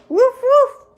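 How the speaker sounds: high, loud spoken woofs; punchy mock dog bark. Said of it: "The dog says".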